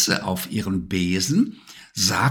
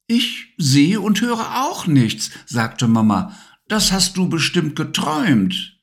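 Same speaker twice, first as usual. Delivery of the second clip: higher, soothing mother; lightly voiced mum; 7 semitones higher than usual. A narrator's reading. Mama telling Levi he only dreamed it, lightly voiced. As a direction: gently brush it off like a mum.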